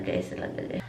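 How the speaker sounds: whisper. Real, not acted. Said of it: "trailing whisper".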